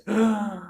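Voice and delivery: high, sharp gasp for air; snatch a quick breath before rattling on. Shown as a demonstration. Tutor mimicking a test-taker grabbing air, sharp gasp. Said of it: "but you're doing this".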